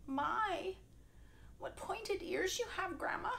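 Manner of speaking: put-on little girl amazed; curious girl. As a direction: marvel at grandma's eyes.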